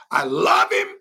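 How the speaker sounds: high, loud, laughing delight; joyful Father's voice. Acted. Preacher voicing God the Father, loud and high with joy. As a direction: burst out with a father's glee.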